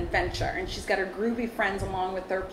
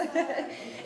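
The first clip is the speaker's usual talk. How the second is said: light laugh; 7 semitones higher, 4 dB quieter than usual. Real, not acted. Laughs after the performance.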